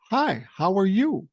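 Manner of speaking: put-on high-pitched small child's greeting; imitated little girl. Granddaughter greeting an elderly stranger, imitated high and bold. Quoting his three-year-old granddaughter.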